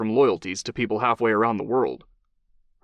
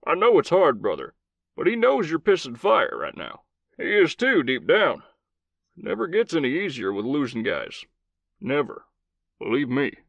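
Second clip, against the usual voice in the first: gruff Southern commander, higher voice; old Southern cowboy voice; 4 semitones higher than usual. Acted. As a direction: play a blunt old cowboy soldier.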